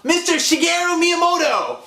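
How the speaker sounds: excited host. Acted. An overexcited show opening.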